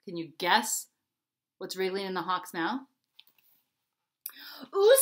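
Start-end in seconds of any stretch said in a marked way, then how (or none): 4.7-5.0 high, loud, impressed ooh; thrilled Mouse. A narrator's reading. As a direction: react with keen wonder.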